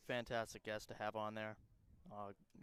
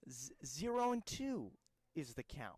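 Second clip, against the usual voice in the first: Reeves Jackson. Acted. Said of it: "get my Reeves Jackson call".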